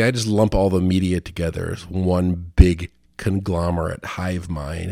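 ordinary talk, nothing marked

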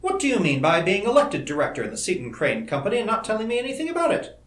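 high, indignant question, climbing at the end; mock-stern challenge rising sharply; demanding. A narrator's reading. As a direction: demand an explanation from a parent. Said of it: "she demanded".

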